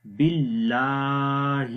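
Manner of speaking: "باللہ" with a thin, light lam, slowly chanted; thin-lam recitation; thin Allah. Shown as a demonstration. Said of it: "لام کو لمبا کریں گے".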